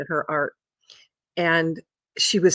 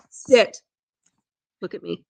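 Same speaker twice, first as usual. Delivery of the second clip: firm dog command. Real, not acted. Owner making the dog sit, firm command. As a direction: order the dog sharply.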